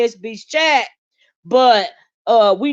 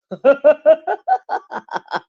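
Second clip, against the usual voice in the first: long staccato cackle; teasing laugh; 3 semitones higher, 3 dB louder than usual. Real, not acted. Host teasing a man about his voice, long laugh. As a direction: crack up after your own jab.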